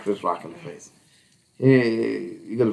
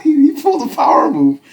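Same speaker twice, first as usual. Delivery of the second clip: higher, louder, energized; excited; 6 dB louder than usual. Real, not acted. Friend hyping up the power move. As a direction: announce it with glee.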